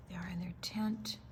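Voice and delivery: hushed aside; soft unvoiced aside about the picture; whisper. Real, not acted. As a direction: breathe it out in a whisper.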